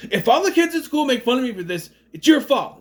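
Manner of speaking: high, loud accusing yell; mock teen yelling at her mom; angry outburst. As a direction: hurl the blame at her. Daughter lashes out at mom.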